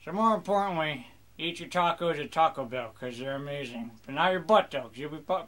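ordinary delivery

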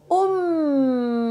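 "um" held with a hum; blended syllable. Shown as a demonstration. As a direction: join U and M into "um". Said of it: "Los junto y dice". (sílaba "um" alargada)

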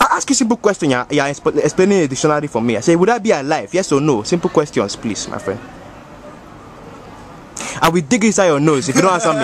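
put-on African-accented uncle voice, unhurried. Uncle bragging to the homies, African accent. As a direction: play the boastful African uncle. Uncle demands simple answer.

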